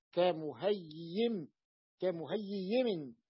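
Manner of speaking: "كمهيم" very slow, ka-mu-hay-yiim; ya cluster demo. Shown as a demonstration. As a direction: stretch the y sounds in "كمهيم" for students. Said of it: "بعد ياء ساكنة، لاحظوا". (نطق "كمهيم" ببطء مع إبراز الياءات)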